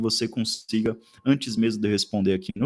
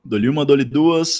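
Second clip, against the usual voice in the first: loud, slow auction call; auctioneer; 3 semitones higher, 8 dB louder than usual. Pretend.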